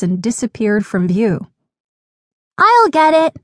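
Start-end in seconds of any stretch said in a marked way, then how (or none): 2.6-3.4 put-on high, louder child's call; little sister's cry. A narrator's reading. Little sister volunteers to chase the ball, eager high cry. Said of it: "cried Mia's little sister".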